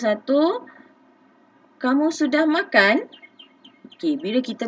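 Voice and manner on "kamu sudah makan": rising question tune, higher; sung-out question intonation; questioning rise. Shown as a demonstration. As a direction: lift the end like a real question. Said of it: "intonasi suara kita pun, okey, ada lenggok dia".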